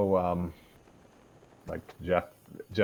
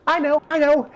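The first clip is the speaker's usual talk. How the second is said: squeaky Pinkie Pie; 8 dB louder than usual. Acted. Narrator voicing Pinkie Pie.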